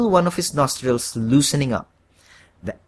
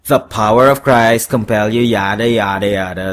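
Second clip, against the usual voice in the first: louder, dismissive recital without a stammer; horned devil's retort; 3 semitones lower, 6 dB louder than usual. A narrator's reading. Devil throws the exorcism line back at the man, dismissively. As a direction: rattle it off as a bored devil. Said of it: "the horned Tom replied".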